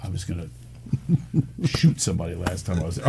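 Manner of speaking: hushed, whispered confession; whispered aside. Real, not acted. Guest whispering a naughty joke to the host, hushed.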